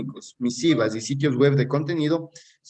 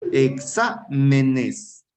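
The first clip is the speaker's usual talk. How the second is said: "Exámenes" drawn out very slowly in separate syllables; much slower than usual. Shown as a demonstration. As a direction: break "Exámenes" into slow, distinct syllables for a class. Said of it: "vamos a analizar".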